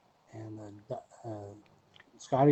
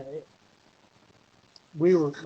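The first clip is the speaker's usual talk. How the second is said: ordinary talk, nothing marked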